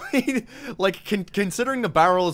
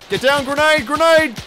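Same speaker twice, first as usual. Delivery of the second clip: loud, urgent warning yell; grenade warning shout; 8 semitones higher, 7 dB louder than usual. Real, not acted. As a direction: shout so everyone ducks.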